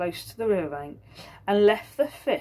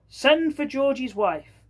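higher, louder, slow order; governor's command; 7 semitones higher, 5 dB louder, slower than usual. Acted. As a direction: command it grandly.

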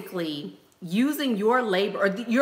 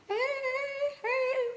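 excited greeting; 7 dB quieter than usual. Real, not acted. Greeting joining viewers on stream.

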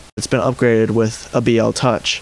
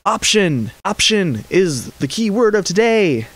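emphatic, raised pitch on "option"; stress on option; 8 semitones higher than usual. Real, not acted. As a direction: lean hard on the word "option", pitched well up. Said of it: "I stress the word option".

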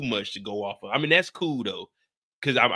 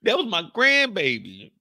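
high mock Monet, higher; 10 semitones higher than usual. Monet bragging about her grandbaby in a high voice. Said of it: "Monet talking about".

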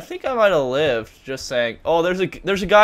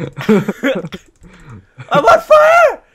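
drawn-out panicked yell; shout; 8 semitones higher, 11 dB louder, much slower than usual. Real, not acted. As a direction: scream it slowly in alarm. Burning in game, shouting.

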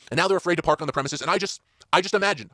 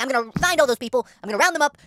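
dictator voice; 8 semitones higher, 3 dB louder than usual. Retold or imitated. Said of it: "I became the health dictator".

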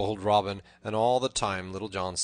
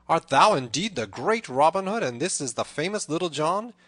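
loud, raised, amazed questioning; amazed cry; 4 semitones higher, 9 dB louder than usual. A narrator's reading. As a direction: exclaim in amazement at who stands before you. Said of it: "cried the tanner".

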